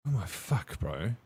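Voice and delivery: soft and deflated, oh my fuck bro; sinking low and quiet in disbelief; stunned, quiet. Retold or imitated. Hearing the doctor's warning, a quiet stunned curse.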